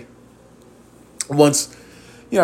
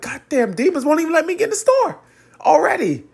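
high, exasperated cry of disbelief; mock outrage, much higher; 13 semitones higher than usual. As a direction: throw your hands up at the demons. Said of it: "I'm like".

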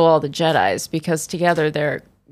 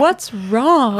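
put-on concerned girlfriend; 6 semitones higher than usual. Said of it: "And she's like".

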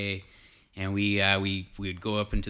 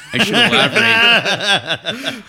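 breaking into laughter; laughing; 13 semitones higher, 14 dB louder, much slower than usual. Real, not acted. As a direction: laugh through the words. Laughing mid-story.

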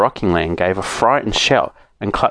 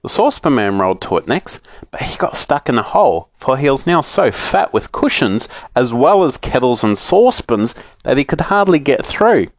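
ordinary delivery

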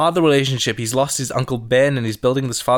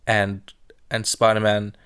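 ordinary talk, nothing marked

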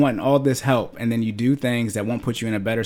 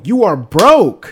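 slow, louder, every word hit hard; emphatic punchline; 6 semitones higher, 8 dB louder, much slower than usual. Real, not acted. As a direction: spell it out to them word by word. Blunt punchline to listeners.

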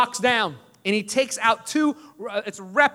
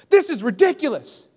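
higher-pitched mock outrage; mad student; 6 semitones higher than usual. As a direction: act out a student's indignant protest. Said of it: "He gets so mad".

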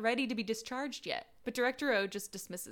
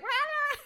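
high mock startled yell; 4 dB louder than usual. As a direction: yelp like he's spooked. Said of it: "freaks out".

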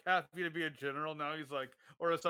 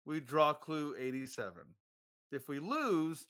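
ordinary talk, nothing marked

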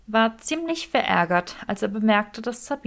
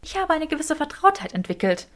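higher female voice, defending herself; defensive Granger; 8 semitones higher than usual. A narrator's reading. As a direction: pitch up and hold your ground. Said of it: "verteidigte Granger ihre Einschätzung".

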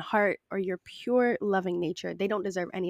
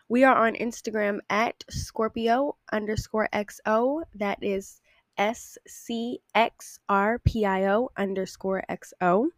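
ordinary delivery

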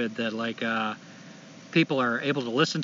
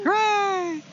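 mock cheer; 3 dB louder than usual. Echoes the cheer.

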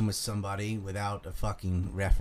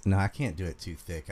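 usual speaking voice

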